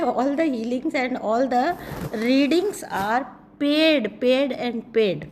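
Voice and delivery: very slow, with PAID repeated heavily; emphatic insistence. Real, not acted. Reader telling entitled viewers about fees, slow and emphatic on "paid". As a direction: stretch out "readings are paid, paid and paid".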